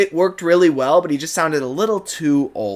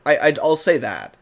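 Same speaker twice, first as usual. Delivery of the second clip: ordinary delivery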